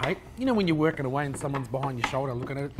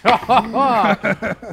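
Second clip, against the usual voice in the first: big hearty burst of laughter; loud laugh; 5 semitones higher, 8 dB louder than usual. Real, not acted. Laughs at friend rushing.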